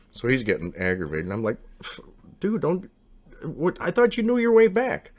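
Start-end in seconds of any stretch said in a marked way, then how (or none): 2.4-5.1 high, slightly louder exasperated protest. Retold or imitated. Narrator protesting to his lost friend in the dark, high and exasperated. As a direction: throw up your hands at him, voice climbing high.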